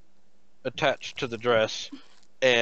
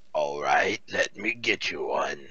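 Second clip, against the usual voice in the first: smoker voice; 9 semitones lower than usual. Acted.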